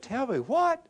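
high, startled question; surprised. Retold or imitated. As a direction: jump up in disbelief. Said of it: "And he said".

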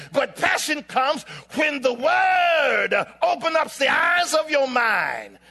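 rising, driving sermon fervor; impassioned preaching. Real, not acted. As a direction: build to a high, loud peak. Preaches at full force.

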